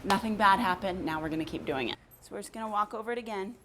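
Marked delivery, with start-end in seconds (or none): none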